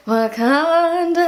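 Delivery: long drawn-out, half-sung place name; awed reaction. Retold or imitated. Fan reacting as the film heads to Wakanda, drawn-out awe. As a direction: stretch out each syllable in awe. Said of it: "And I was like".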